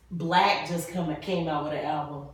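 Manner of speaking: ordinary talk, nothing marked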